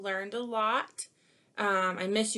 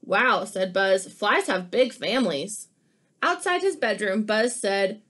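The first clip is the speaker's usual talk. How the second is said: lively picture-book read-aloud; animated storybook reading; reading to children; 5 dB louder than usual. Acted. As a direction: read it like story time for kids.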